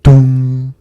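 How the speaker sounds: loud, booming "dong" held on one note; clock chime. A narrator's reading. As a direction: imitate a striking clock, ringing out the hum. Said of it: "said the clock".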